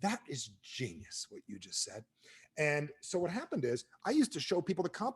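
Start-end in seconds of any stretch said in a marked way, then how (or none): 0.0-2.0 slow, lower and softer imitated mentor praise; drawn-out, hushed praise from a wise old mentor; Jim Rohn voice. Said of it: "Jim Rohn would say".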